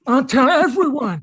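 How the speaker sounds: character voice. Acted. Voiced puppet character threat.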